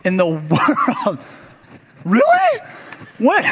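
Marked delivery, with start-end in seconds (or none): 2.0-3.4 high-pitched incredulous squeak; mock disbelief. Pastor to congregation after a show of hands, mock disbelief. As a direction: react in playful, squeaky disbelief. Feigned disbelief at audience's reaction.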